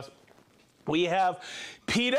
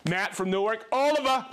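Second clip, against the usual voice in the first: normal talking voice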